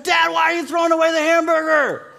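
put-on high, loud child's protest; son's outcry. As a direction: cry out like an upset kid.

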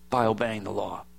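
"by obeying the law" dropped low and soft, falling on "by"; soft falling echo. Acted. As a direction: let "by obeying the law" sink away quietly. Echoes verse softly, falling.

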